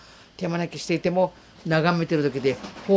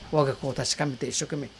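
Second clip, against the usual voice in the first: normal talking voice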